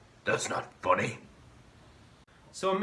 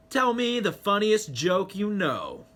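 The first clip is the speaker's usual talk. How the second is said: usual speaking voice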